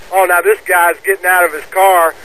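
ordinary talk

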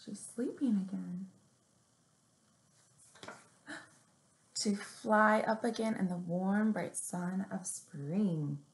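gentle picture-book read-aloud; story reading. Acted. Librarian reading a picture book to little kids, gently.

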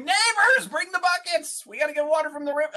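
high-pitched hollering to the neighbors; play-acted high, loud cry for help; mock scream for help. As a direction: pretend to yell out the window for help, very high and urgent. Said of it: "put your windows down and scream".

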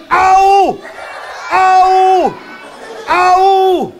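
loud, high yelps of pain; pain cries. Acted. Robber bitten on the bottom by the dog, yelping. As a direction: yelp loud as if just bitten.

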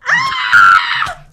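high, loud, drawn-out shriek; battle scream. Real, not acted. Player attacked by her rival, high scream. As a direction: scream in the heat of the fight. Fighting for her game life.

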